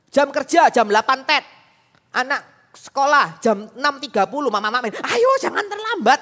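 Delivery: mock strict rushing. Strict weekday rush to school.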